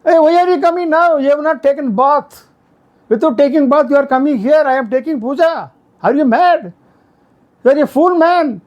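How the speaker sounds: retold angry shout. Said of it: "she immediately shouted at her husband".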